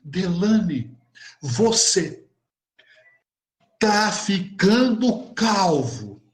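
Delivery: halting, drawn-out reveal with long pauses; retold confidential reveal. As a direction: imitate a grave secret, word by word. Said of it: "Ela abaixou o tom de voz e me dizendo assim". (segredo revelado pausadamente)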